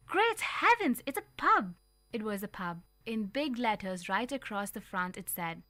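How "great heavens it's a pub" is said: high, loud exclamation of shock; mother shocked he went into a pub. A narrator's reading. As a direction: gasp it out, scandalised.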